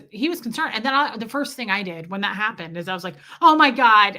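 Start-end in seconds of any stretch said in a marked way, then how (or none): 3.4-4.2 a loud panicked oh my god; retelling a moment of sudden panic; freaked-out gasp. Retold or imitated. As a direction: blurt it out in sudden alarm. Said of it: "I started freaking out".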